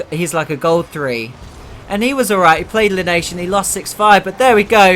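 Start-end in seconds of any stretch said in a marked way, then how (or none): 1.9-5.0 louder and higher as the goal comes; loud caster hype; excited goal call. Real, not acted. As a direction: build into a goal shout. Goal called mid-anecdote.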